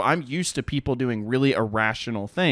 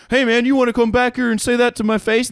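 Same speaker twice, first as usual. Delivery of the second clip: mock tough guy; 9 semitones higher, 3 dB louder, faster than usual. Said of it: "some dudes get really eloquent".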